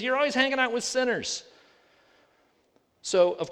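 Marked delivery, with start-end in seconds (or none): 0.0-1.4 accusing Pharisees. Retold or imitated. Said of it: "They were looking at Jesus".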